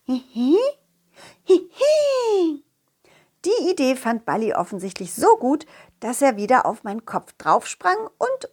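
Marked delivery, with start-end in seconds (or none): 0.0-2.6 very high squeaky giggle; tiny creature giggle. Acted. Balli giggles under the pot, very high and squeaky.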